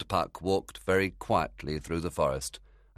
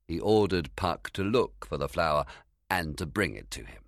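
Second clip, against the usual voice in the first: usual speaking voice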